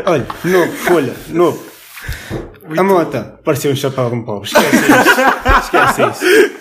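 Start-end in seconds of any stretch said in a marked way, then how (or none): 4.5-6.5 high, slow laughing exclamation. Real, not acted. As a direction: squeal it out through laughter. (a rir, agudo e arrastado)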